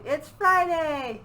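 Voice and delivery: loud, high, stretched-out shout of joy; excited yell. Acted. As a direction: yell it out slowly like school just ended. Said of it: "yelled Junior".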